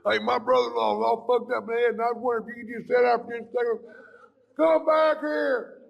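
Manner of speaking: a pestering fan turning nasty; pushy stranger. Retold or imitated. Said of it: "without somebody going".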